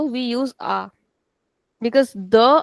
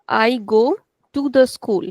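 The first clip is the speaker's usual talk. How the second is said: usual speaking voice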